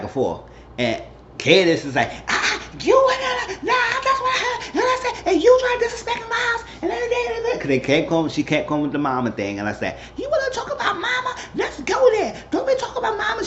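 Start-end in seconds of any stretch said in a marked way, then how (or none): 2.3-7.6 very high, rushed mock rant; heated arguing. Acts out Candace arguing back. 10.2-13.4 very high mock confrontation; combative challenge. Acts out a challenge over mama.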